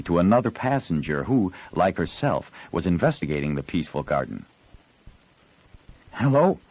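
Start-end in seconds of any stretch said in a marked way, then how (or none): 6.1-6.6 bright, high, friendly hello; surprised but cordial. Acted. Girl surprised by hidden boy, cordial. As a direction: greet a stranger with startled warmth. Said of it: "in a tone between surprise and cordiality".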